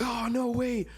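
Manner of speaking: mock impressed girl. Girls reacting, mimicked.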